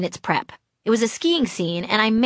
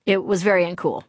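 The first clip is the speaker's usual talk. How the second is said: ordinary delivery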